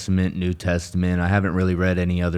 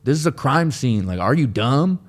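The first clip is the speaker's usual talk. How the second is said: high, loud, exaggerated cop voice, yelling at a cyclist; mocking cop; 9 semitones higher, 4 dB louder than usual. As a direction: ham up a bossy cop barking orders. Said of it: "the cops right there were like".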